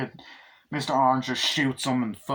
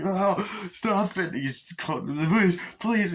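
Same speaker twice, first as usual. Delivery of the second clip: begging cop; 8 semitones higher, slower than usual. Retold or imitated. Cop begs during the torture.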